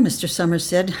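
ordinary delivery

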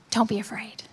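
very soft, hushed comfort; gentle reassurance. Acted. Jesus to people in a storm, softly reassuring. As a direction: drop to a near-whisper to reassure.

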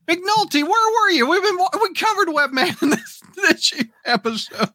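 high-pitched exasperated protest; indignant, high-pitched reproach; incredulous scolding. Real, not acted. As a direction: call out the listener in disbelief. Mock indignation at viewer's question.

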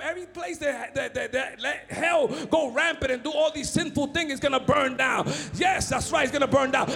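loud, fast fiery preaching; shouted preaching. Acted. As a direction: thunder the warning at the congregation. Preacher shouting about burning.

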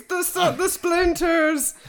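very high, drawn-out mock begging; pleading villager. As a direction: beg in a hammed-up, squealing villager voice. Villager bit resumes.

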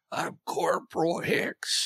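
put-on slow, breathy, gravelly old man. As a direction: rasp it out like a tired old soldier. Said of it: "real old man voice".

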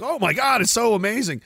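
high, gushing imitation of a new fan; mock starry-eyed excitement. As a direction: gush like someone just discovering it. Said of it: "when you first, like, discover stand-up comedy".

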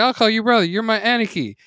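put-on high, loud girlish voice; bright eager little sister; squealing little sister voice; girl calling brother. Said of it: "she's like".

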